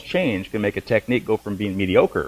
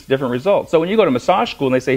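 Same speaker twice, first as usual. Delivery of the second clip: ordinary delivery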